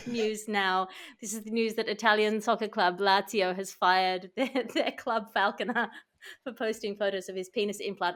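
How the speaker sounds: ordinary delivery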